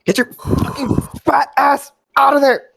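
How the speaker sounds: very high, strained cry; straining rescuer. Acted. Character pulling stuck friend free, straining. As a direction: force it out as if heaving a heavy body.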